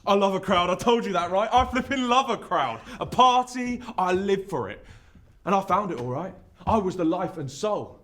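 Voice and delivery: loud, high party-animal bragging; full-force, high-energy swagger; exuberant boasting. Acted. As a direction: crow about it to the whole crowd. Boasts of his party days.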